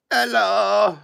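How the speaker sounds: high, loud drawn-out hello; big high-pitched holler. Real, not acted. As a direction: call out a long, high hello. Teasing call to young viewer.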